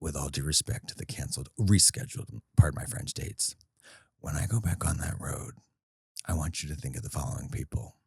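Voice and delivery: low, soft scheming purr; a diabolical demand told to the manager, low and hushed. Acted. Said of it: "I'm gonna do this in this voice 'cause it's diabolical".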